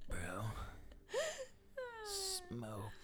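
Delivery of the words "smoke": high squeak. Pretend. High silly voice.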